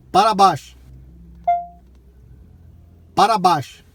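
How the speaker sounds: loud repeated command; talking to voice assistant. Real, not acted. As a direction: call it out to the car.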